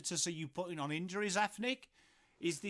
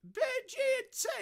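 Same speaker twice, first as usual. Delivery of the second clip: very high squeaky toff voice, even more hammed-up; over-the-top mock fancy voice; 5 dB louder, faster than usual. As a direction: push the pretend toff to a squeal.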